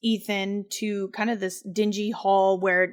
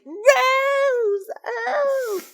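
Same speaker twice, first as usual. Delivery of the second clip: much higher, put-on old woman's voice; creepy old lady. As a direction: squeak the name up high like a kooky old crone. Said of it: "And she's like".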